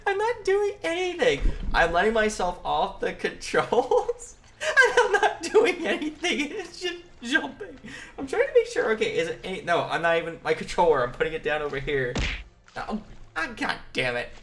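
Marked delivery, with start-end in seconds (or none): 0.1-8.9 words breaking into giggles; laughing protest. Real, not acted. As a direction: protest through real laughter. Laughing protest as game glitches.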